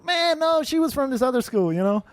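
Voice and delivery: high, whiny cover story; pleading fake excuse, pitched high then easing down; mock defensive bluff. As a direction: act out a teen dodging with a lie. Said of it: "I'd always have to make up stories".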